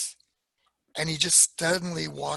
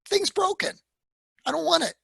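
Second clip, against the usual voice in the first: high, louder voice for Carmen; fed-up girlfriend; 12 semitones higher, 6 dB louder than usual. Retold or imitated. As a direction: push the box back, high and loud. Carmen fed up, returning box.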